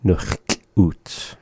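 slow careful native word; teacher's model. Shown as a demonstration. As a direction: say nuchtluts cleanly, k sound, no L. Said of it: "how do you say it".

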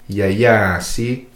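second YA drawn out before SEE; dragged vowel. Shown as a demonstration. Teacher repeats the spelled word, second YA dragged. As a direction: hold the second YA long. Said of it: "So you could hear how it's dragged".

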